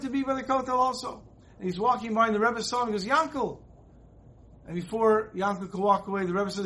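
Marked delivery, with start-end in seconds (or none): 3.1-3.6 calling out. Retold or imitated. Rebbe calls out at Kotel.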